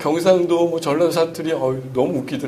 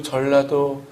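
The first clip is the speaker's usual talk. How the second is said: normal talking voice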